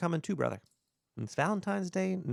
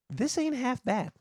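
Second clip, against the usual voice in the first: mock pleased; 8 semitones higher than usual. Mock pleasure during rubbing.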